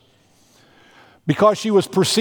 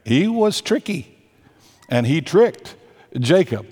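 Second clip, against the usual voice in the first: usual speaking voice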